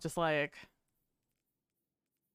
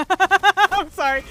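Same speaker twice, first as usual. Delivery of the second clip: much louder, high and slow through laughter; laughing apology; 10 semitones higher, 16 dB louder, slower than usual. Real, not acted. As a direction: blurt it out while cracking up. Laughing at another player's misplay.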